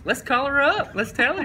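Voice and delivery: high, loud coach voice; coach impression. Coach in his office deciding to phone the player's mom, high and eager. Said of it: "sitting in his office".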